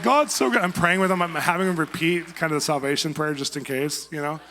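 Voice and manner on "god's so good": high, loud burst of joy, retold; gushing with sudden delight; excited praise. Newly moved couple praising God, high excited gush. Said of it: "They were like".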